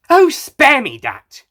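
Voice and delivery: high, loud, slow scornful outburst; exclaimed. A narrator's reading. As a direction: fling it back in exasperation. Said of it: "she exclaimed".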